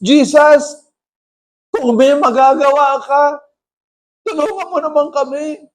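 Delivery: high-pitched acted plea; pleading father. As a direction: beg Jesus for help as the father. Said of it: "sabi ng tatay". (nagmamakaawang tatay, mataas ang boses)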